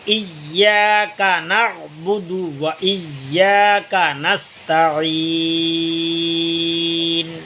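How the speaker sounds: slow drawn-out tajweed chant; Quranic recitation. Shown as a demonstration. Teacher reciting the verse for students, slow and drawn out. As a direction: recite it slowly, letting the last word stretch.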